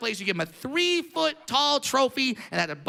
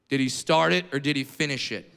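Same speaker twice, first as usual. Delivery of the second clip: lower, a hint of a no-nonsense old-school dad; dad's blunt question; 8 semitones lower than usual. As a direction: ask it flat and matter-of-fact like a tough father. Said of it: "He goes".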